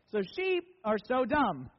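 ordinary talk

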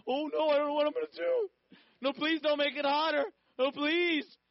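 high, loud, fake-helpless pleading; hammed-up fear, mocking the threat; mock panic. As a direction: beg in sarcastic, exaggerated dread. God mockingly feigns fear of the king.

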